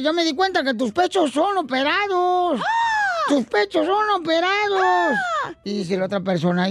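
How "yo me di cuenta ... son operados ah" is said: high, drawn-out nightclub yell; acted shout with stretched endings; calling out. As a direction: holler it over the dance music, slowly. Dancer calling out in noisy nightclub.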